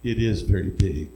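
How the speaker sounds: low, soft, measured reply; God. Retold or imitated. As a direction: answer calmly from deep down. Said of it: "And God said".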